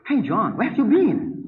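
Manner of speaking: slow, native-sounding English greeting; slow mock American English; imitating native English. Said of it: "Bahasa Inggris kayak orang Inggris".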